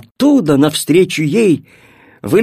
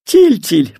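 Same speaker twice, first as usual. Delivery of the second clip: usual speaking voice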